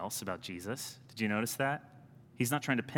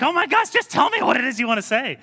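much higher and louder, fed-up plea to just get on with it; impatient exasperation; 12 dB louder than usual. Retold or imitated. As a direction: burst out, sick of the buildup. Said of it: "And you're like".

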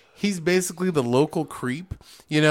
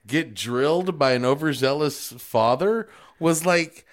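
ordinary talk, nothing marked

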